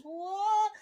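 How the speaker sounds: very high, loud squealed cry; mock shriek. Startled film characters screaming, very high mock shriek. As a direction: shoot up into a shriek. Ape screams back at Toad.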